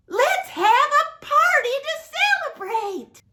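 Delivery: high, loud, joyful call for a party; excited announcement. Acted. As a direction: throw the line up high with delight. Father announces celebration.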